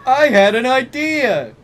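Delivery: high, loud sudden idea; excited exclaim. Acted. Vampire announcing his plan, a high loud exclamation. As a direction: blurt it out with excitement. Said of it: "he exclaimed".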